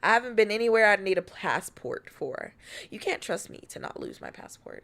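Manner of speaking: ordinary delivery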